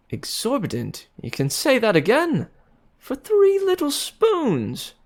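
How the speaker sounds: put-on young man amazed at the spoon price; good-humored surprise. A narrator's reading. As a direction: marvel at the price. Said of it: "whistled, good-humoredly".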